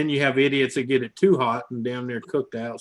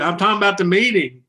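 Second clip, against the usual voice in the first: louder, higher, insistent; raised voice; 8 semitones higher, 9 dB louder than usual. Retold or imitated. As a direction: push it up, insistent boss. Boss repeats, raised voice.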